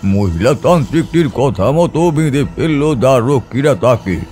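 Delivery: ordinary talk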